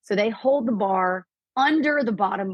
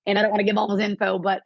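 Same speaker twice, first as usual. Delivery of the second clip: normal talking voice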